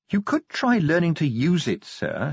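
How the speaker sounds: a hint of a teasing constable; lightly voiced dry advice; lightly voiced Angua. Angua advises Vimes.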